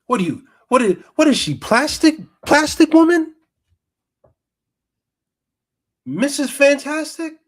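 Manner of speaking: high, loud mocking disbelief; incredulous shout. Real, not acted. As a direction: shout it in stunned ridicule. Mocking the Karen's behavior.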